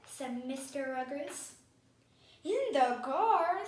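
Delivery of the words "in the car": loud, drawn-out echo question, higher; astonished question. A narrator's reading. Amelia Bedelia hearing the camp is in the car, astonished. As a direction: repeat it slowly in disbelief.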